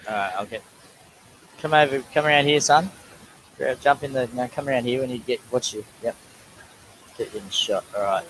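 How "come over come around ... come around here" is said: calling son over. Real, not acted. Directing son into the shot.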